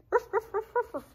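high, loud happy ruffs; dog barks. A narrator's reading. Dog barking to his friend, high and loud. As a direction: bark playfully like a puppy. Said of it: "barked Oscar".

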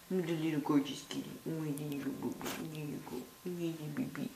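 soft cooing. Real, not acted. Cooing softly to the pet.

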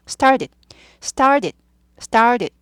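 "started" repeated staccato with a d-like middle t; t softened to d. Shown as a demonstration. Teacher drills learners by repetition, flapped t in "started". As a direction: drill "started" as "star-did", one pop at a time. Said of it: "트 발음이 드 발음이 약화되죠".

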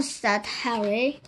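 ordinary delivery

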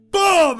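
loud, high "Boom!" impact; punch sound effect. Pretend. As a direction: shout the punch noise. Imitating a punch.

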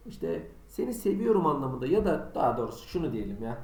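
ordinary talk

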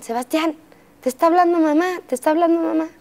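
put-on high, insistent call of a little girl; little sister calling. Sister calling Sebastián to answer mom, high and insistent. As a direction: call his name like his big sister would.

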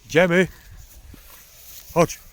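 loud, high call to the dogs. Real, not acted. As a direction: call the dogs to come along.

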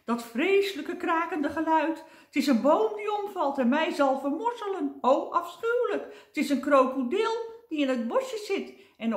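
high, loud wail of mock terror; hammed-up frantic shrieking; panicked screeching. A narrator's reading. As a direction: screech as if doom is closing in. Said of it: "krijste hij".

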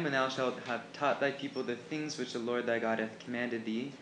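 usual speaking voice

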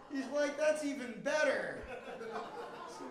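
normal talking voice

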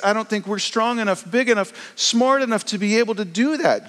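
high, anxious pleading voice; panicked disciples. Retold or imitated. Disciples begging Jesus not to leave, high and anxious. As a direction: sound like scared followers left on their own.

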